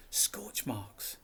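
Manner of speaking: hushed unvoiced clue-finding; whispered discovery. A narrator's reading. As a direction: breathe it out under your breath. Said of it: "he said".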